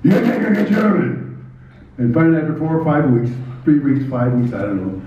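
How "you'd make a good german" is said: higher-pitched quote of another wrestler; imitated fellow wrestler. Champion wrestler sizing up a new guy, imitated high voice. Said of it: "he'd say, he said".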